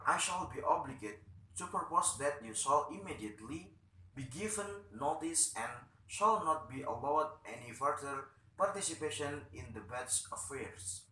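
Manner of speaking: ordinary delivery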